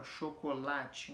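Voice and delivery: "chocolate" slowed down, with a strong, long "la"; stress on LA. Shown as a demonstration. Teacher showing the penultimate stress, slow "chocolate" with weighted "la". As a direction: measure out "chocolate" slowly, landing on "la". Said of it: "o lá é mais forte".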